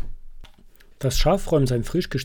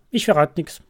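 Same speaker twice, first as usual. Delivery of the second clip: high, louder cheeky mouse squeak; 4 semitones higher, 7 dB louder than usual. Acted. Mouse teasing the waiting friends, high cheeky squeak. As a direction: pipe it up like a cheeky little mouse. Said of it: "piepst sie frech".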